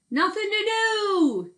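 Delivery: high, slow bored yell; long, high, drawn-out holler. Acted. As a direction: shout it into the hollow log. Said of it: "he yelled into the log".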